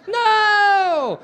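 very high, very loud held shout; mock scream. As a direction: cry out in exaggerated horror. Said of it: "I want you to go".

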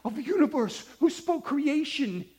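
high, loud, pleading model prayer; fervent prayer. Shown as a demonstration. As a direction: call on God with mounting urgency. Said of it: "We pray".

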